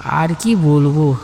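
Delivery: ordinary delivery, nothing marked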